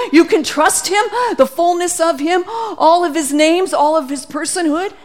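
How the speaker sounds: high, loud, rapturous; a thrilled Spirit bursting with joy; excited Holy Spirit voice. Acted.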